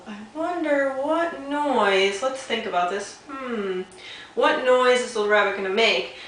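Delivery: high, slow, drawn-out wondering; wondering aloud. Shown as a demonstration. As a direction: make the kids guess along. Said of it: "and you could say".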